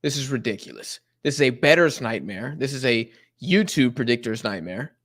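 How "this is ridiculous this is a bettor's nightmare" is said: ordinary talk, nothing marked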